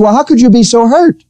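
a puzzled pastor pleading with his hurt brother; baffled question. Retold or imitated. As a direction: ask it in honest confusion.

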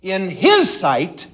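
"his" leaned on, slowly; slow down and press "his"; stress on his. Shown as a demonstration. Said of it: "triple underline the word his".

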